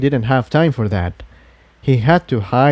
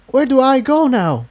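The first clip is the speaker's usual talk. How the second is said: put-on higher, lighter young voice; young dragon voice; 12 semitones higher than usual. Spike asks the voice where to go. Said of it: "he asked".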